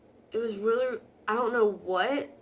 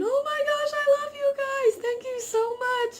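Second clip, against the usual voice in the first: high-pitched mock fangirl squeal; retold fan scream. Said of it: "and I was screaming".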